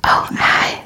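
hushed whisper. Pretend. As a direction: breathe the words without voice. Whispered skit after break.

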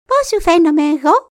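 high eager mink voice. Acted. Mink asking the raven, in a high eager voice. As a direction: squeak it up like a small eager mink. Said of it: "Κατέβηκε στην παραλία και του είπε".

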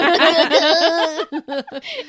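big shrieking laugh; loud laugh. Real, not acted. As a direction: burst of loud high laughter. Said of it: "He's like".

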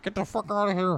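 high-pitched retold "get out"; mock urgent dismissal. Julie sends Luke off from the window, high mock urgency.

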